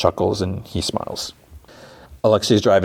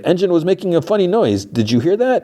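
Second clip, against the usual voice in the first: high-pitched bluffing excuse; acted-out nervous bluff in a high voice; feigned innocence; 11 semitones higher than usual. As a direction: play innocent, voice pitched right up. Driver bluffing about engine noise.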